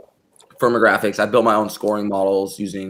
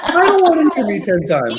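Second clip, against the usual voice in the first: laughing; 13 semitones higher than usual. Real, not acted. Echoing joke, laughing.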